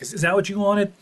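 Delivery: mock angry Don. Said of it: "he's like".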